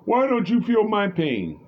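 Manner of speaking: high, loud mock wail; imitated scream. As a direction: shriek it out, imitating her outburst. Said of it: "she screamed out of nowhere".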